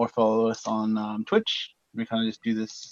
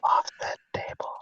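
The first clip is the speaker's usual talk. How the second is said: ASMR whisper; 6 dB quieter than usual. Pretend. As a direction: breathe it out like an ASMR video. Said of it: "it's like A S M R".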